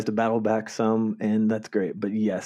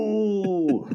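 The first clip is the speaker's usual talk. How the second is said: mock ooh; 9 semitones higher than usual. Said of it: "I also went".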